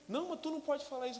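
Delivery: wife telling him off, quickly; wife scolding husband for doubting God. Retold or imitated. Wife rebukes, voiced.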